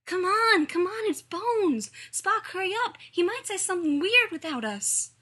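put-on high, loud, eager kid voice; impatient excited child. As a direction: urge your friend on, loud and high. Impatient child urges friend to hurry.

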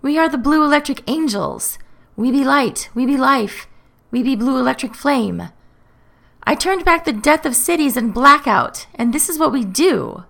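high-pitched, self-important protest; irritable, indignant. A narrator's reading. Matthew defends his job as Mayor, indignant. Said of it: "Matthew shifts irritably on the spot".

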